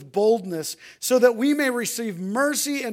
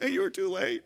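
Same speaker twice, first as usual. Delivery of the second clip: mock dismissive dad; 9 semitones higher, 4 dB louder than usual. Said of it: "turn away and say".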